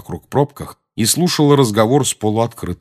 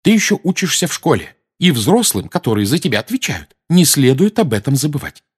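high, raised-voice lecture; scolding; 7 semitones higher, 4 dB louder than usual. A narrator's reading. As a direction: scold a schoolboy in a raised pitch. Mrs Weasley scolds Harry. (отчитывая высоким голосом)

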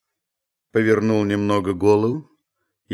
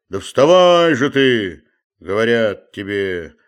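loud, high bellow of exasperation; roaring; 3 semitones higher, 7 dB louder than usual. A narrator's reading. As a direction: roar at the sleeper to get up. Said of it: "заревел было Захар".

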